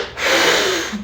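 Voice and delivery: long forceful exhale; imitated blowing-out breath. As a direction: blow the air out audibly, as if out a window. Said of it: "blow out the window".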